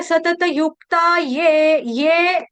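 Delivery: "ये" slowly, with a clear y onset. Shown as a demonstration. Teacher modelling for students, slow clear "ये". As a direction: stretch "सतत युक्ता ये", ending on a long ye. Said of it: "ये करेंगे".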